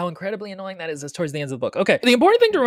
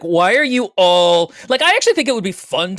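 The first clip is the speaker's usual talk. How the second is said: exasperated; 4 semitones higher, 7 dB louder than usual. Retold or imitated. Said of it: "I was like".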